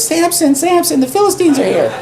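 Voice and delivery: high-pitched mock alarm, half laughing; hammed-up Delilah calling out in false panic; mock Delilah cry. Delilah wakes Samson, hammed-up high alarm. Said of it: "She goes".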